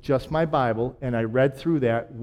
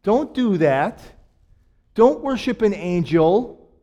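sudden loud, higher cry of an angel stopping John; Ho! bursts out high and loud, then firm, slow warnings; angel rebuking John; 6 semitones higher, 9 dB louder, slower than usual. Retold or imitated. Angel stopping John from bowing down, loud high rebuke. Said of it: "and the angel's like".

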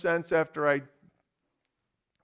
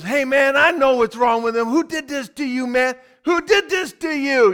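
high, loud street hustler; street guy voiced; 8 semitones higher, 7 dB louder than usual. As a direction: play him up, loud and higher. Plumed-hat guy voiced.

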